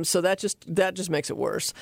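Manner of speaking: ordinary talk, nothing marked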